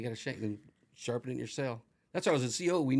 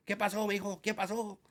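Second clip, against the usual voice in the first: put-on high, pleading Spanish-accented grandma; grandma yelling in Spanish; 8 semitones higher, 4 dB louder than usual. Grandma pleading with the cops for her grandson, high and loud. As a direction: beg the cops like a frantic grandmother. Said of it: "My grandma's yelling at him in Spanish".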